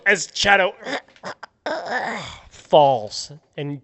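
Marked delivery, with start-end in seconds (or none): 1.2-2.3 strangled cough as the knife hits; dying choke cough. Acted. Game master voicing the stabbed villain, choking cough. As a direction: gag out a death cough.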